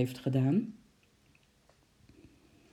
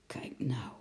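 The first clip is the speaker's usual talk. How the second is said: whispered, much softer; hushed mutter; 4 semitones lower, 8 dB quieter than usual. A narrator's reading. Uncle whispers to the others in wonder, hushed whisper. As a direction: breathe it in amazement. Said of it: "mompelde oom Nijltje".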